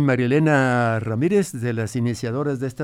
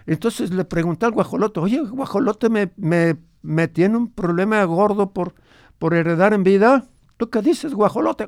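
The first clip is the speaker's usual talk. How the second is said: lightly voiced grandfather, a bit higher; old grandfather; 6 semitones higher than usual. Grandfather recalls his ranch for the radio host, lightly voiced old man. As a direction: tell it as the old abuelo remembering his ranch.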